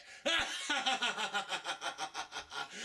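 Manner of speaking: high villainous cackle; evil laugh. Acted. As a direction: cackle with glee.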